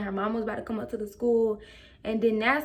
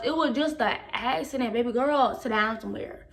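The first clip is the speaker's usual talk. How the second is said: ordinary talk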